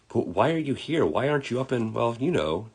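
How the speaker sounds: high-pitched curious questioning; puzzled questioner. Acted. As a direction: ask it high and hesitant, trailing into a hint. Child-like questioner voiced in story.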